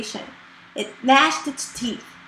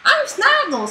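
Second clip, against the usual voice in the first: dragon head Snaggle; 12 semitones higher than usual. Acted. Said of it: "said the head with the longest fangs".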